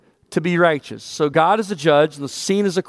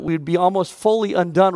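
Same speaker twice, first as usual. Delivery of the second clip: usual speaking voice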